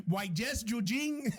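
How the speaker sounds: put-on slow, thick Spanish-accented English, half laughing; parents' heavy accent. Host replicating his parents' talk, heavy Spanish accent. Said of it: "see if I can replicate it".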